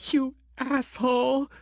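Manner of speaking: high, furious scream in a woman's voice. Acted. Christine screams at Grant for abandoning them, high and furious. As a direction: scream it right in his face. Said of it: "Christine screamed".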